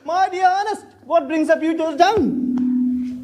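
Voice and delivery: high, slow, drawn-out welcome; delighted greeting. Acted. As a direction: greet the old friend with open arms. Host greets arriving friend warmly.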